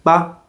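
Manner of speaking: short, light "ba"; "ba" as a quick unstressed syllable; neutral tone. Shown as a demonstration. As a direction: say "ba" briefly, without a glide. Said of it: "there's also a neutral tone".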